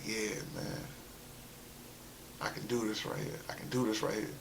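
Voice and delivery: very soft, low-key aside; quietly reenacted private thought; hushed self-talk.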